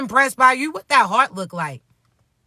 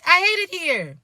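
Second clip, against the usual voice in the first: higher-pitched quoted movie line; movie character quote; 6 semitones higher than usual. Retold or imitated. Coach quoting a karate movie character, mildly put-on complaint. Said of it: "How Shorty say off of that little karate movie".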